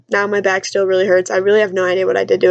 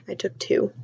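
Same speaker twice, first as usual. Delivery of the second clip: hushed whisper, owning up to the smaller number; whispered confession; 10 dB quieter than usual. Real, not acted. Confessing to the viewers she only took two, in a whisper. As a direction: drop to a secret whisper to correct yourself. Admitting the real Motrin count.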